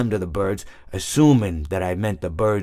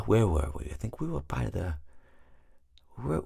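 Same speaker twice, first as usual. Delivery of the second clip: trailing mumble; 10 dB quieter than usual. Real, not acted. Losing track of his story.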